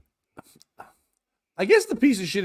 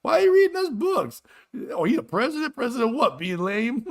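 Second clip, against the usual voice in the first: put-on high kid voices; 8 semitones higher than usual. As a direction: play confused schoolkids, high and indignant. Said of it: "they're like".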